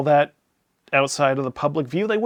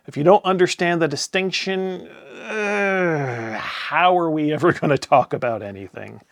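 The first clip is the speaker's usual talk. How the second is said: ordinary talk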